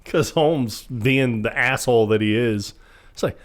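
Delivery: usual speaking voice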